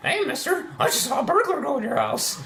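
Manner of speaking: hammed-up little girl. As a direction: fib to the man like a sly kid. Voicing girl in story.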